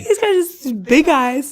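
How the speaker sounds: slow, laughing, high. Real, not acted. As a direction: laugh through the words. Laughing about the stare.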